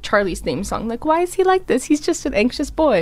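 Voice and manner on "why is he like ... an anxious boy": mock quote. Voicing the song's theme.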